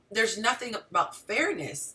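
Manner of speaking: normal talking voice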